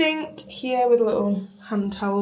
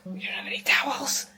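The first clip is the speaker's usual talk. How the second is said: dismayed whisper, voice dropped away; 5 semitones higher, 8 dB quieter than usual. Real, not acted. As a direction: whisper it to the viewers in mock despair. Discovering no towels again.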